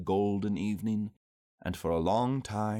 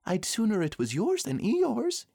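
high little-animal voice; Piglet; 13 semitones higher than usual. A narrator's reading. Piglet loyally to Pooh, high small voice. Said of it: "said Piglet".

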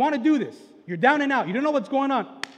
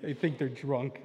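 softer, half-laughed, amused; laughing it off; 6 semitones lower, 6 dB quieter than usual. Retold or imitated. God and Jesus joking about the crowd, laughing softly. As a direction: chuckle through the line at a good joke. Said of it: "God and Jesus are just cracking up".

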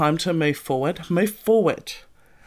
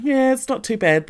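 higher, airy brush-off; mock breezy denial; 8 semitones higher than usual. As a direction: pretend everything's fine. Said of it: "you're sitting there going".

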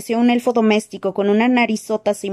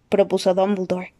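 usual speaking voice

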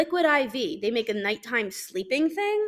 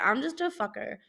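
ordinary talk

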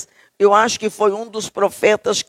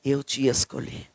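tender God; 4 semitones lower than usual. Retold or imitated. Preacher voices God comforting Jeremiah, low and tender. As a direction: speak like God soothing his chosen. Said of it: "ele disse".

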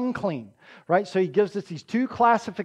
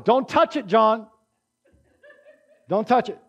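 emphatic warning. Acted. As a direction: warn a friend off the last piece. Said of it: "this is how emphatic that is".

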